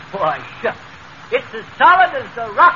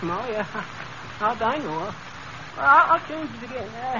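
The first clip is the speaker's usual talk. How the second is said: ordinary talk, nothing marked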